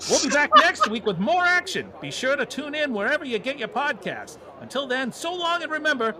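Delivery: high, excited play-by-play wrap-up; baseball announcer voice. Acted. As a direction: call the game like a radio announcer. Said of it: "baseball announcer voice".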